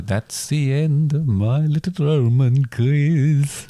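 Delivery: slow, stretched-out quiz sign-off; drawn-out sign-off. Pretend. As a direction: draw every word out to close the quiz. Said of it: "You keep doing that now. Is that a thing now?".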